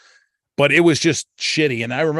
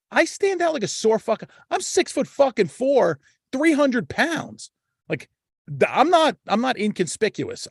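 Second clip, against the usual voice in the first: much higher, pleading exasperation; exasperated high protest; 10 semitones higher than usual. Retold or imitated. Protesting to the boss, high-pitched and exasperated. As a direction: squeal it out, higher and higher in disbelief. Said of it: "I'm like".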